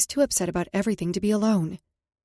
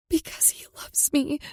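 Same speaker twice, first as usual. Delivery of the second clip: whispered confession; 7 semitones higher than usual. Acted. Tearful confession to mom.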